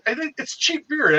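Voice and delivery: slow and pitched high, easygoing offer; lifted, drawn-out offer; high, slow coaxing. Retold or imitated. Urging friend to take beer.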